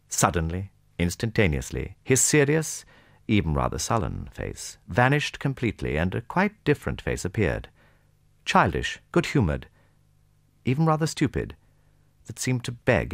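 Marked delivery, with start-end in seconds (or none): none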